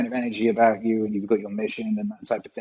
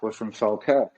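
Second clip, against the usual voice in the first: ordinary delivery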